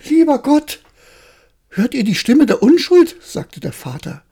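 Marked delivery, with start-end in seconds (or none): 0.1-3.2 high, loud amazed exclamation; father marvelling at his child; father exclaiming. Acted. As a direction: cry out in wonder.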